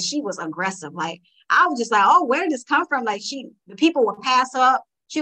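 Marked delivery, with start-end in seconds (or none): 2.0-3.0 surprised. Retold or imitated. Surprised by daughter's pushiness.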